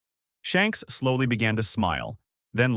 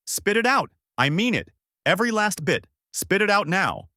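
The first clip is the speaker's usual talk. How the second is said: much higher, frantic commanding shout; yelling; 9 semitones higher than usual. Acted. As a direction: order him desperately, full voice. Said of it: "yelling out".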